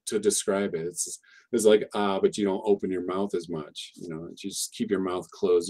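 ordinary talk

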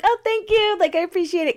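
high-pitched, gushy social thanks; polite routine thanks. Retold or imitated. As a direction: retell a stock thank-you in a high voice. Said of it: "'cause everyone says that".

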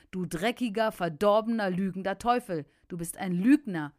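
loud, indignant denunciation; angry rebuke. Retold or imitated. Preacher rebuking the lying devil in his car, angry and loud. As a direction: hurl the insults at the devil.

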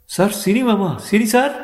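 high, loud cry of shock; stunned dismay. Acted. Sundar hears the father goes to asylum, shocked cry. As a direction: blurt it out, thrown by the news. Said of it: "Sundar திகைத்து".

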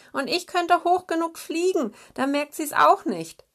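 high, shaky-brave little hen; a touch of tremble behind bold words; trembling voice. A narrator's reading. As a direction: snow grouse speaking with a quavering voice. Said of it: "mit zittriger Stimme".

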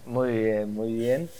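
hoarse, raspy just-woken voice; raspy morning voice. Real, not acted. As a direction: gravel of someone barely awake. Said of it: "esa voz aguardentosa por las mañanas".

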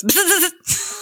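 high-pitched mock buzz; imitated buzzer. Locke teasing Jack with a dynamite stick, imitated buzzer. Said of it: "picks up a stick and goes".

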